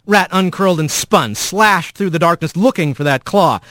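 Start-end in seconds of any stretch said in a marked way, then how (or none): none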